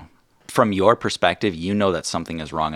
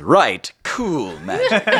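genie voice; 7 semitones higher, much slower than usual. Acted.

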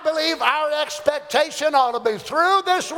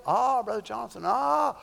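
mock moan; 2 semitones lower, 6 dB quieter than usual. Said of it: "And you go".

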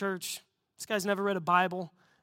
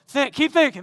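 eager urging; 5 semitones higher, 8 dB louder than usual. Retold or imitated. Urging silent man to decide.

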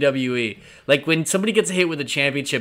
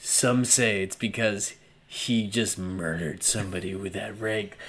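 spooky gossip; 8 semitones lower, 7 dB quieter, slower than usual. Retold or imitated. Mock spooky Buzz gossip.

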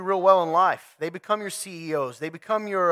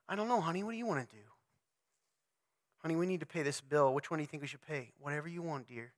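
much softer, limp and noncommittal; passive husband; 4 semitones lower, 13 dB quieter, faster than usual. Retold or imitated. Passive husband leaves every decision to his tired wife. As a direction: shrug it all back to her.

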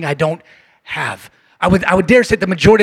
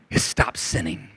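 whispered condition; 12 semitones lower, 10 dB quieter than usual. Pretend. Pastor whispering the one condition to Dev, hushed. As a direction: drop to a whisper on the catch. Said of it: "what if I say".

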